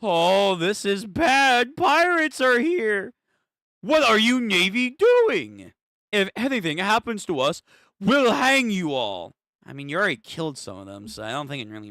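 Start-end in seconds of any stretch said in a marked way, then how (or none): 0.0-3.1 high, loud, stretched-out cry of alarm; acted alarm. Reads alarmed character's line aloud. 3.8-9.3 acted outrage. Reads threat to navy aloud.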